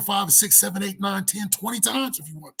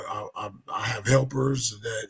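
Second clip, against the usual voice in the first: usual speaking voice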